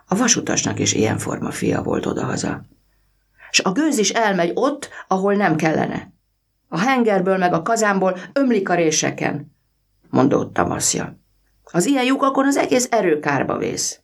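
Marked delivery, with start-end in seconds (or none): 3.5-9.5 put-on boy's voice. A narrator's reading. Boy Vaszja voiced in story. 11.7-14.0 put-on boy's voice. A narrator's reading. Boy Vaszja voiced in story.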